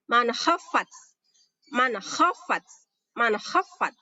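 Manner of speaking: "khafat" with kha scraped in the throat; deliberate recitation of "Man khafat"; throaty kha. Shown as a demonstration. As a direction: clear n in "Man", then a rasping "khafat". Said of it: "Kha ya, pakai tenggorokan".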